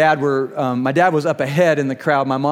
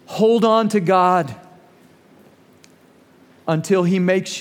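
slow sermon close. Real, not acted. Closing exhortation.

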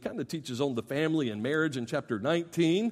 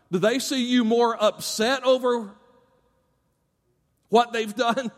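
normal talking voice

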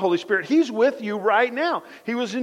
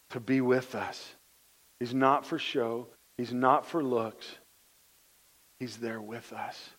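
tender sermon; 7 semitones lower, 6 dB quieter than usual. Acted. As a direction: draw the congregation close, gently. Preacher turns soft and intimate.

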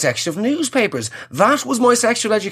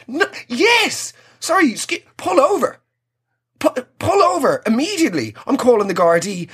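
outraged passenger; 6 semitones higher than usual. Pretend. Outraged woman in the taxi.

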